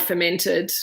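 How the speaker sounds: ordinary talk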